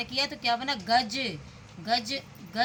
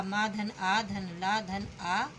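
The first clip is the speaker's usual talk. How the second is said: slow spelling out; 3 semitones lower, much slower than usual. Shown as a demonstration. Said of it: "इसी तरह से माला".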